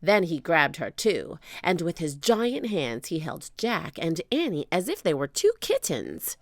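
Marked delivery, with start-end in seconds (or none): none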